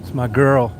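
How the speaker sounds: normal talking voice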